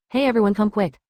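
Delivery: plain reading voice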